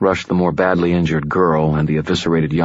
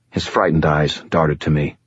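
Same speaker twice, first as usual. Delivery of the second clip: plain reading voice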